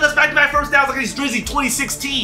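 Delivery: high, loud, rolling excitement; energized high-pitched hype talk; hyped up. Real, not acted. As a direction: pump yourself up as the drive clicks. Hyping himself during a drive.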